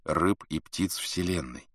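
plain reading voice

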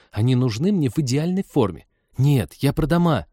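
a hint of an insistent girl; young woman character. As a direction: correct them in a slightly girlish voice. Voices a girl in story.

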